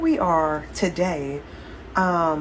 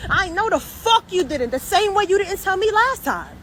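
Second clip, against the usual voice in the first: heated, higher accusing tone; angry reproach; 12 semitones higher, 3 dB louder than usual. Real, not acted. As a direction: throws the past back at them. Ranting at the relative.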